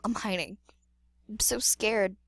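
ordinary talk, nothing marked

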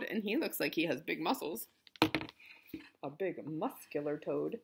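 plain reading voice

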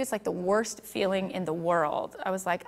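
ordinary delivery

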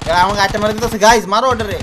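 loud urgent shout to the team. Real, not acted. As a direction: yell for help under fire. Under fire, shouting to team.

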